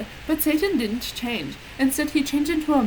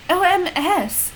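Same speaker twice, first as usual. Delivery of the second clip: cried out; 8 semitones higher, slower than usual. A narrator's reading. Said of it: "cried Vampire".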